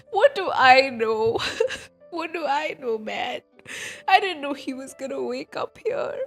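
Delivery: ordinary talk, nothing marked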